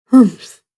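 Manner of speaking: a loud, short huff of annoyance; grumpy humph. A narrator's reading. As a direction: huff like a cranky old wolf. Grumpy wolf huffs.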